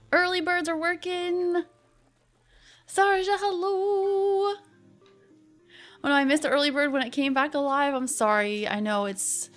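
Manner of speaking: ordinary delivery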